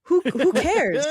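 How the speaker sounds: dismissive. Real, not acted. Dismissing complaints about accents.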